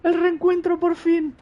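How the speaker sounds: loud, high, overjoyed; thrilled shout. Real, not acted. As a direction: cheer the long-awaited reunion. Brothers reunite on screen.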